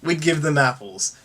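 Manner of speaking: ordinary talk, nothing marked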